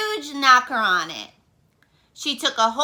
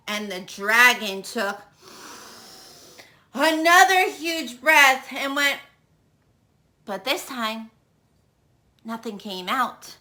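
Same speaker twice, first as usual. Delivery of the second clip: ordinary delivery, nothing marked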